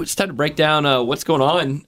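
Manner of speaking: normal talking voice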